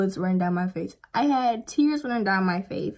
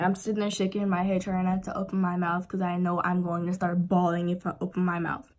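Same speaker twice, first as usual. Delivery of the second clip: ordinary delivery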